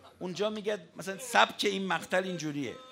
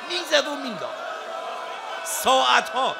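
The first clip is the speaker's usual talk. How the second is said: high, loud climactic preaching shout; preaching at full force; 6 semitones higher, 7 dB louder than usual. Acted. Preacher describing the battle to mourners, shouting. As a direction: cry it out to the mourners.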